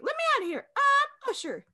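very high-pitched celebrity imitation. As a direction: squeal it way up high like a cartoon captive. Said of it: "do the [name] voice".

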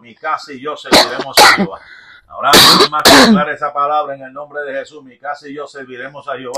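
loud, forceful preacher's declaration; fervent prayer declaration. Real, not acted. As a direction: declare it boldly, at full volume. Declaración de oración en voz alta.

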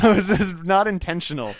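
laughing while apologizing. Real, not acted. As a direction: chuckle through the line.

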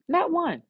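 high, punched "Not one"; emphatic. Acted.

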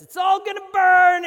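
huge high shout of doom. Retold or imitated. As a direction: yell it like a street prophet.

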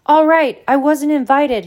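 loud, high-pitched angry outburst. A narrator's reading. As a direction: blurt out the admission furiously. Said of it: "he said angrily".

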